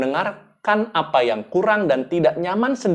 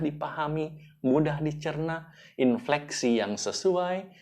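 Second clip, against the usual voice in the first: much softer, slowing down to touch the listener; soft moving tone; 7 dB quieter than usual. Shown as a demonstration. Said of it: "ingin mengajak orang untuk menangis".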